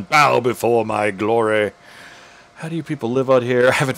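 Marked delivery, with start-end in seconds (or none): 0.0-1.7 slow, pompous royal decree; mock grandiose. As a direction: play a self-important ambassador demanding worship. Reading a grandiose dialogue option.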